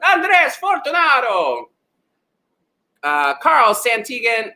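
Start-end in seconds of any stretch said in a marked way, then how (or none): 0.1-1.7 high, drawn-out theatrical flourish; showy name announcement. Shown as a demonstration. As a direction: savour every syllable of the name, pitched high. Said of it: "How can you not say that name like that".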